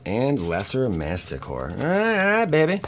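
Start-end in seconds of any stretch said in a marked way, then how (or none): 1.7-2.8 hyped cheer. Real, not acted. Hyped card reveal.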